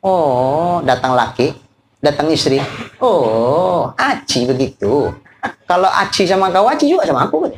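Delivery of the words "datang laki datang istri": usual speaking voice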